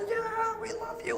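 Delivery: high, soft swooning fans; mock adoring fans. Preacher imitating Trump fans, high and swooning. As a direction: play the starry-eyed supporters lightly.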